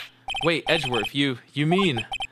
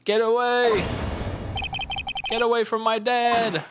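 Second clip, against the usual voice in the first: put-on high frantic child's yell; a boy shouting to save his dad; 9 semitones higher than usual. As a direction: scream at the man to get away. Child yelling at attacker.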